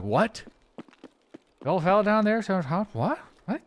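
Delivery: put-on high, questioning Newfoundland accent. Streamer imitating a confused Newfoundlander in a thick Newfoundland accent. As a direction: play a puzzled Newfie in a thick Newfoundland accent. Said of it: "You're like".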